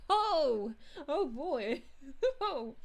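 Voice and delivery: ordinary talk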